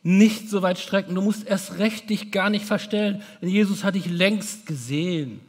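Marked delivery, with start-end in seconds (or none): none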